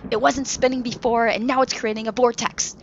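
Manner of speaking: captain warning crew of the vortex; urgent shout. Acted. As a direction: shout the danger over the wind. Captain shouting over storm.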